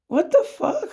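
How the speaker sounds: high, shocked what the fuck; exasperated shock; stunned disbelief. Real, not acted. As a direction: reel at the absurdity. Disbelief at being accused.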